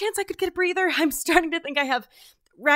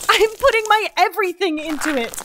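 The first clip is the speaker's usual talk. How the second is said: louder, effortful reply; strained protest; 3 semitones higher, 6 dB louder than usual. Acted. Trainee protesting while hauling, strained. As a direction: push it out through effort.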